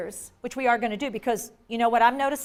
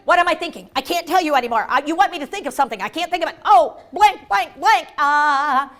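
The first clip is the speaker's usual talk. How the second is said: overwhelmed, bursting out louder on the blanks; flustered; 2 semitones higher, 4 dB louder than usual. Acted. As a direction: act out a mind jamming under pressure. Acts out overloaded brain failing.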